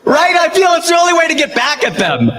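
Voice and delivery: high, gleeful revenge glee. Real, not acted. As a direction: relish the payback.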